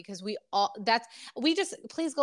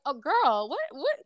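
shocked reaction; 3 semitones higher than usual. Retold or imitated. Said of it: "I'm like".

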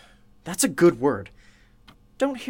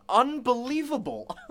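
laughing disbelief; 6 semitones higher, 4 dB quieter than usual. Real, not acted.

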